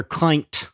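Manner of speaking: Clint said as "Clynt"; long i in Clint, as in pint; rhymed with pint. Shown as a demonstration. Co-host extending the rhyme joke, Clint rhyming with pint. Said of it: "make them rhyme with pint".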